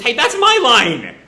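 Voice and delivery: high, loud and slow protest; a hammed-up Sarada shouting back; mock indignant shout. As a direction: throw the line back at him, indignant.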